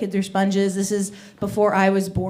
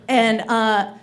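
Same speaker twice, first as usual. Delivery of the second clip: usual speaking voice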